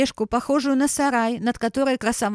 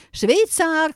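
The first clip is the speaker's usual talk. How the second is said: louder insistent call to the doorman; calling again; 6 semitones higher, 4 dB louder than usual. A narrator's reading. Hen calls the absent doorman a second time, louder. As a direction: call out once more, harder. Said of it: "крикнула она ещё раз".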